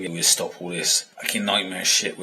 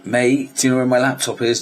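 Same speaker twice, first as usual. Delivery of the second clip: louder and higher, quick shout from downstairs; calling up the stairs; 6 semitones higher, 5 dB louder than usual. Retold or imitated. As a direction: call out to a mate upstairs. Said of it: "I could hear this voice going".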